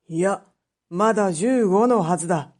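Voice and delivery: put-on an old judge offering mercy; stern magistrate. Magistrate presses her to lie.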